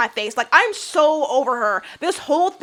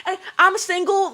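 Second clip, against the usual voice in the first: mocking Ashley; 6 semitones higher, 3 dB louder than usual. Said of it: "it gave".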